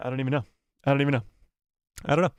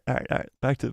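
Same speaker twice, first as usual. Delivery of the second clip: usual speaking voice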